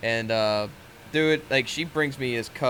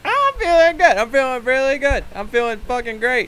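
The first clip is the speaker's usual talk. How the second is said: loud, high-pitched sarcastic cheer; sarcastic enthusiasm; 13 semitones higher, 9 dB louder than usual. Acted. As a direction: overdo how great you feel, with sarcasm. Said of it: "That sounded like a little sarcastic".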